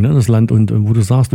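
ordinary delivery

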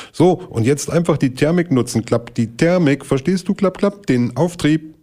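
a know-it-all stork showing off mid-flight; eager stork calling back. Acted. Storch ruft im Davonfliegen. (hoher Storch, ruft im Wegfliegen)

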